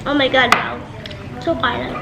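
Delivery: ordinary talk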